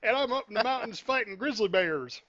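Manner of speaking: mock southern accent. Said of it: "I don't know why he went southern".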